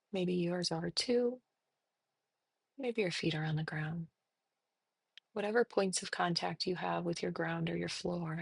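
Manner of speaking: soft, unhurried meditation voice with long pauses; guided meditation. Real, not acted. Therapist leading listeners through breathing and body noticing, soft meditation. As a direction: guide them gently into settling, softly.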